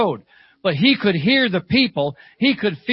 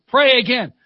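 higher, firmer repeated demand; insistent; 4 semitones higher, 3 dB louder than usual. Retold or imitated. Old lady asking once more for prayer, insistent. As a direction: insist on another prayer, imitating her.

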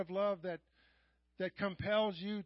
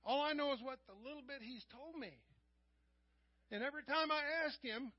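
ordinary talk

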